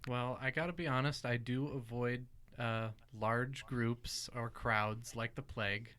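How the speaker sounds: normal talking voice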